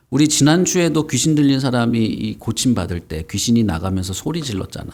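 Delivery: ordinary talk, nothing marked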